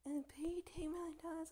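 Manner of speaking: high mocking put-on voice for their demand; mock kidnapper demand. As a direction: squeak their demand back in a silly high voice. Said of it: "And they're like".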